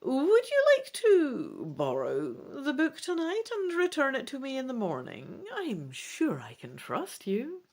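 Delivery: put-on high old woman's voice, softening to the end; high, sly old woman voice; old shopkeeper woman. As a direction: coax the stranger like a knowing old merchant. Madame Ursula offers the book.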